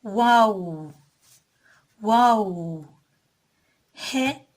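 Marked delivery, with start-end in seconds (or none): 0.0-2.9 "Wow" with lips pushed forward, rounded; Arabic letter waw. Shown as a demonstration. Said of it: "Dudakların uçlarına ... ileri uzatarak". (dudakları uzatarak "vav")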